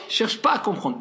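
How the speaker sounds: ordinary talk, nothing marked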